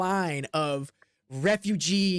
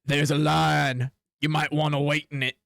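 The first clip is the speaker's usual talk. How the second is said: tough adventurer; 2 semitones lower than usual. Acted. Adventurer blocks player in line.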